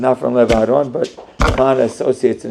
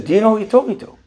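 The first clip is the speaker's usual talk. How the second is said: raised, pointed rhetorical question; stern scolding; 8 semitones higher, faster than usual. Retold or imitated. Syrian man scolds the young owner, pointed. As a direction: put the boy in his place.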